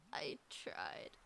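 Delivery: breathy whispered aside, deflated. Real, not acted. Gamer failing to catch fish, deflated whisper. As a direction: whisper it to yourself after failing.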